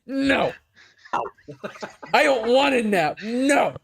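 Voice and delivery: put-on high whiny toddler voice; whiny child. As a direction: whine like a tired four-year-old. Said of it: "like a four-year-old kid being told".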